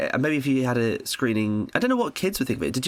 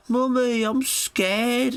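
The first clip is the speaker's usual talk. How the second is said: put-on scared little kid; 14 semitones higher, much slower than usual. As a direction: squeak it like a frightened little kid. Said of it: "the little kid went like".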